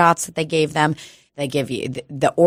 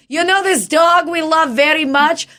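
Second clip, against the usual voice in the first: high-pitched squabbling parents; hammed-up, high bickering shout; mock screaming argument; 10 semitones higher than usual. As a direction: act out parents yelling over each other. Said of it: "they're fucking screaming at each other".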